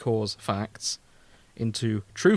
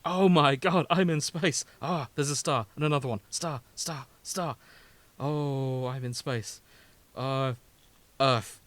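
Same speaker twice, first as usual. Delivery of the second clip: dim robot voice; 2 semitones higher, 3 dB louder than usual. Retold or imitated. Host impersonating the Space Core, panicking to go home. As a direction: whine like a simple robot scared of space. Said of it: "And then he's like".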